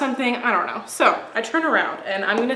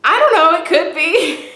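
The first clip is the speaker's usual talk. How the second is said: loud, high-pitched silly answer tipping into laughter; high-pitched mock reply; 7 semitones higher, 8 dB louder than usual. Answering the stranger's come-on in a loud, high-pitched mock voice. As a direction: jump up high and loud, playing yourself flustered.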